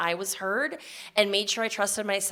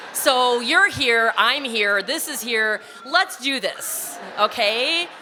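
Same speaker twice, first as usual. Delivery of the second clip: psyched up; 3 semitones higher, 7 dB louder, slower than usual. Retold or imitated. Psyching herself up to begin.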